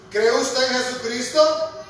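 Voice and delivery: normal talking voice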